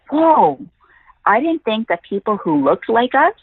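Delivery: put-on high-pitched wondering gasp of a young fan; amazed whoa. Retelling the girl's amazement that someone like them is on TV, awed. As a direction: let the whoa lift high in disbelief, then settle.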